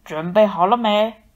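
wolf dad roaring at the lambs; wolf growl. A narrator's reading. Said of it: "野狼爸爸吼着".

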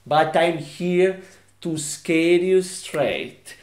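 slow, measured warning at normal volume. Acted. Ex-con warning the office staff, slow and restrained. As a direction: say it slowly and evenly, holding back. First take of convict's warning.

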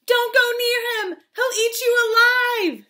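high, loud alarmed shout; yelled warning. Acted. Townspeople to boy and father, alarmed yell. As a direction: yell to stop them. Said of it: "People yelled".

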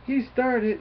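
high-pitched panicky mock yell; retold yell. Bully freaks out at the sudden arrival, retold yell. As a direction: imitate a bully yelling in a panic. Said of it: "He yells up".

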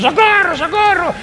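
very high, wailing mock cry for help; Kevin gritando socorro. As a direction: play a drowning child yelling for rescue. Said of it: "Imaginen que es Kevin gritando". (grito agudísimo de auxilio, actuado)

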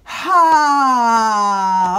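long, loud vocal exhale; loud breath-out "haa". Shown as a demonstration. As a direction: let the breath out on a sustained sound. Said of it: "Let some sound out".

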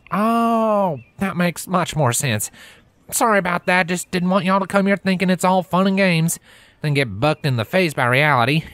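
high, lilting country mare, firm; a country mare warning a city pony; Applejack's country voice. Acted.

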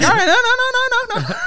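very high, rapid string of refusals; mock flustered child, squealing high; panicky kid. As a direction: pitch it way up like a frantic kid.

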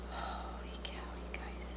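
awed breathy whisper to the viewers. Real, not acted. Vlogger whispering in awe to viewers. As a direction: whisper it like a secret. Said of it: "it just makes me whisper".

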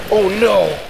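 usual speaking voice

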